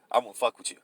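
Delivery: ordinary talk, nothing marked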